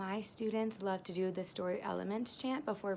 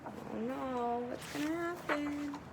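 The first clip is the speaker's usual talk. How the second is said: higher, playful suspense; mock worry; 7 semitones higher, slower than usual. Teacher asking the kids what happens next, mock worried. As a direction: tease the children with mock alarm.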